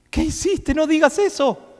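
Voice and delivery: a high, mildly hammed-up protest from a worried follower; mock the fretful disciple scolding his master, a touch high; whiny disciple, lightly mocked. Preacher mocks a disciple complaining to Jesus: high, lightly hammed-up protest. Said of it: "habrá dicho".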